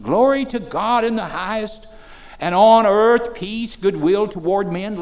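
loud, ringing pulpit proclamation; preaching at full force. Acted. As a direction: preach it out to the room. Quotes scripture with force.

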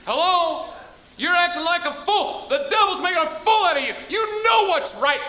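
high, loud rebuke; preaching shout. Real, not acted. Preacher to rowdy youth, a high loud rebuking shout.

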